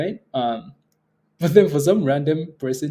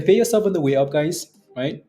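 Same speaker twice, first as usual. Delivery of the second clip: normal talking voice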